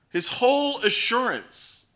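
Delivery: ordinary talk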